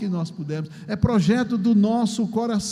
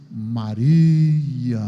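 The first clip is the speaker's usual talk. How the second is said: lower, louder, tender calling of a name; voice of Jesus; 7 semitones lower, 6 dB louder than usual. Acted. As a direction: call her name gently as Jesus. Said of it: "Aí Jesus disse".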